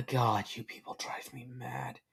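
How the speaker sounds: exasperated whisper on a sigh. A narrator's reading. Stranger despairs of the questioning filly, sighing whisper. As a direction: breathe it out, weary and very soft. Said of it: "Sighing, he says".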